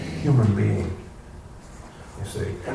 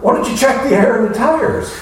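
higher, cheeky ask of God; mock request; 9 semitones higher, 3 dB louder than usual. Preacher mocking a worshipper's chore list, cheeky and high.

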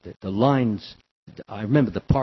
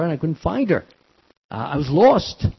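normal talking voice

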